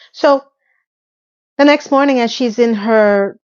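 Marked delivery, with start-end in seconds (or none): none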